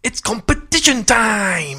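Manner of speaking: high, loud, slow announcer shout; jingle shout. Shown as a demonstration. Host redoing the competition intro as a big shout. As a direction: belt it like a show jingle. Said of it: "Much better".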